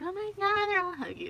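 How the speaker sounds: high, mock-gushing voice imitating cooing couples. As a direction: mimic the sappy couples, high and cutesy. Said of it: "they were like".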